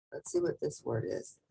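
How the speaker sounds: quick, soft aside to self; quiet aside while reading; muttered aside. Real, not acted. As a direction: check the page under the breath. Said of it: "aside checking the page".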